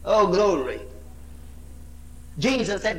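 ordinary talk

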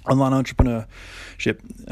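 talking through a yawn; slow, yawning words. Real, not acted. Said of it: "sorry, I'm just yawning".

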